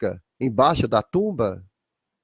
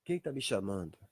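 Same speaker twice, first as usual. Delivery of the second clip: very soft, low question; hushed wondering; 8 semitones lower, 15 dB quieter than usual. Acted. Abraão hearing someone call him, hushed wondering. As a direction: ask it under your breath, puzzled. Abraham hears a distant voice.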